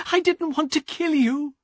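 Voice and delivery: high, desperate confession; young woman sobbing remorse to her brother; anguished woman. Acted. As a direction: beg forgiveness in anguish. Elena's remorse over brother.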